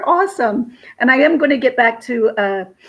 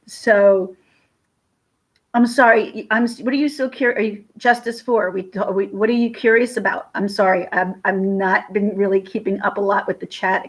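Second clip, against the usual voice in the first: usual speaking voice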